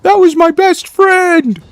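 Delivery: high, loud hammed-up wail; mock crying. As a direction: cry out like a bereft friend, overdone. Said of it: "He's, like, crying".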